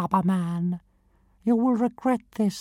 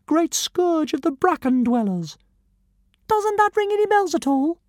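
much higher and fast, rattling off grand titles; fast pompous titles; 10 semitones higher, 4 dB louder, faster than usual. Acted. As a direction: reel off your titles proudly at speed. Dragon indignant, very high fast.

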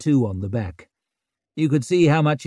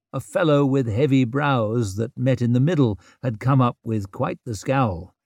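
ordinary delivery

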